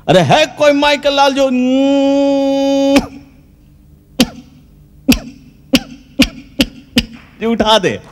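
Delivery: coughing, stumbling, then squeaking up high; a nervous stage actor tripping over his big line; stammering Angad. Acted. Nervous Angad on stage, choking on his challenge, stammering and cracking high. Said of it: "Angad बेचारा थोड़ा सा हकलाता है".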